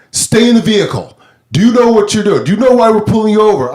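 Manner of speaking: loud, high angry cop voice; yelling cop. Retold or imitated. Cop shouting at driver to stay in car, loud angry. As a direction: bark the order at the driver. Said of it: "he's like".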